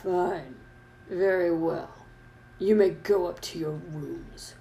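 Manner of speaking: quiet, slow professor voice; low, measured, grudging permission; Snape. Acted. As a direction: concede softly, drawing out the words. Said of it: "but Professor Snape said".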